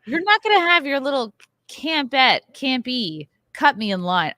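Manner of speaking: hammed-up telling off of a teen; imagined rant. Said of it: "been like".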